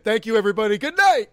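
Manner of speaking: loud, high stage farewell; joking showman's goodnight, swelling on the last words; mock sign-off. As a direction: call it out like a performer leaving the stage. Mock show sign-off after performance.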